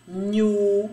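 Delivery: "nhu" as one long held syllable; drawn-out NHU. Shown as a demonstration. Teacher showing pupils another nh sound, drawn-out "nhu". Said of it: "O som de".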